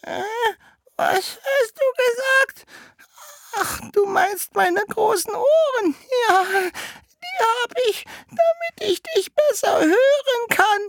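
very high, slow, loud falsetto; wolf as grandmother. Acted. Disguised wolf answers Rotkäppchen from bed, slow falsetto granny. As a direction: drag it out in a squeaky fake granny voice.

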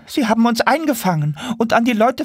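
high, squealing monkey complaint; caged monkey. Acted. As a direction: chatter it out in a high voice.

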